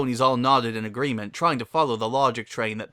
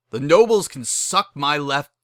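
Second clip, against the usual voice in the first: a louder, hammed-up snarl; mock angry outburst; 4 semitones higher, 7 dB louder than usual. Pokey fumes at the nobles, mock angry. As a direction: spit it out at the nobles.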